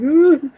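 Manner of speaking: high, loud pained whimper; short hammed-up cry of hurt; mock crying groan. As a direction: imitate bursting into tears from pain. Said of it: "even though I started crying".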